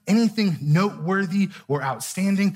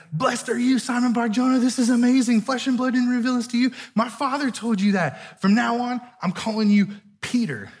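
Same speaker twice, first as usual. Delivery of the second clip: higher, bright, pumped-up delight; excited Jesus; 5 semitones higher than usual. Retold or imitated. Jesus thrilled at Peter's answer, excited praise. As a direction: burst out like he can't contain the joy. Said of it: "He gets excited".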